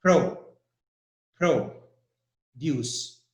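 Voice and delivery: teacher isolating the stressed "pro" before a weak "deuce"; stress on first syllable. Shown as a demonstration. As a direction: break it up, punching "pro" and letting "deuce" fall away. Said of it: "The stress is on P R".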